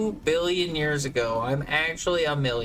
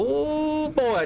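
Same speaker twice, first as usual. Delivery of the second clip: delighted whoop; 12 semitones higher than usual. Real, not acted. Gushing over sweet tea.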